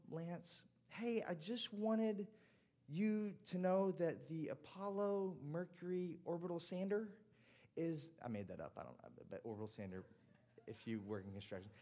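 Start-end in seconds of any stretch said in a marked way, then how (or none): 0.1-8.1 higher, slow, sing-song auntie call; lightly voiced excited aunt on the phone. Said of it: "my aunt's best voice".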